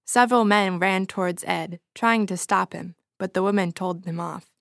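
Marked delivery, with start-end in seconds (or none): none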